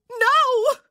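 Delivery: piercing high, loud scream of refusal. A narrator's reading. Wife refusing to hear the news, screaming. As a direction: scream it out in anguish. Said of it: "she screamed".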